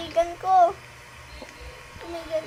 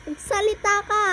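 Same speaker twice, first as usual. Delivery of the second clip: shouting; 3 semitones higher, 6 dB louder than usual. Real, not acted. Confronting the monster.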